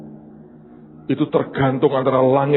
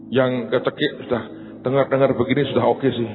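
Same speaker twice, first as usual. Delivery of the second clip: normal talking voice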